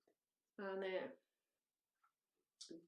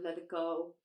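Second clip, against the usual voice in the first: normal talking voice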